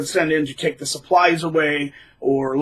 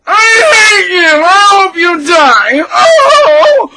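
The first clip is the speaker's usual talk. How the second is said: high, slow, loud hammed-up scream; over-the-top raging narcissist; mock furious shriek; 11 dB louder, much slower than usual. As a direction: shriek the hate like a cartoon villain. Narcissist's rage, mocked.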